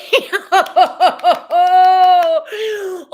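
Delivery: loud high jolly laughter; hearty laugh. Acted. Spirit arriving delighted, laughing loudly. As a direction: throw back a big joyful laugh.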